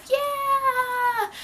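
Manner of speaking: high, drawn-out squealed cheer; hammed-up cheer. Vlogger urging viewers to subscribe, playfully cheering.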